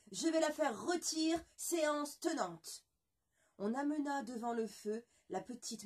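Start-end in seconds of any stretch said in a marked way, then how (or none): 0.1-2.9 loud, slow menacing threat; furious threat. Acted. Le roi menace de rôtir la moitié de poulet, furieux. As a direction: threaten her with relish. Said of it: "s'écria-t-il".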